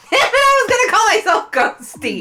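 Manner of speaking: high, loud protest through laughter; laughing outrage. Real, not acted. Player whose partner took their chosen name, loud laughing protest. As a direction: cry out laughing, pitch way up.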